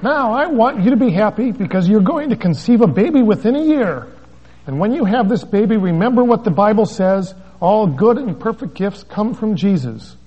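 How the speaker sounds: very high, loud, bubbly evangelist voice; Frances Hunter gushing. Retold or imitated. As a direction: gush like a delighted faith healer. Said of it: "Frances speaking to the wife".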